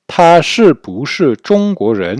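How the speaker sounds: emphatic teacher reading; slow model question. Shown as a demonstration. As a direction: spell out the shi bu shi question clearly. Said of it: "la forme alterno-interrogative".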